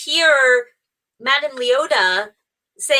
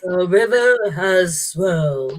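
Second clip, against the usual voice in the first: low, hint of a creepy butler; 6 semitones lower than usual. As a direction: say it like a sinister butler. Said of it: "creepy butler voice".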